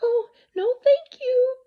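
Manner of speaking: put-on a small girl begging her mother; excited little girl. A narrator's reading. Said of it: "Lisa answered".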